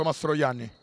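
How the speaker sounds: ordinary talk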